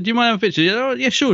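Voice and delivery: high, dainty posh voice; polite posh exchange. Retold or imitated. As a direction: play both sides sweetly. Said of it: "I said".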